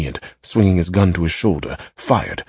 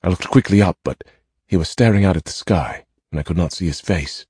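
plain reading voice

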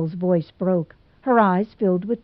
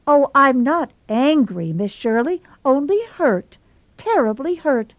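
a touch of tears in a higher voice; tearful; 7 semitones higher, 3 dB louder than usual. Acted. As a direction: speak through held-back tears. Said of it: "voice positively foggy with tears".

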